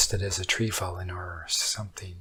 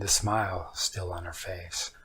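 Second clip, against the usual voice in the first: ordinary delivery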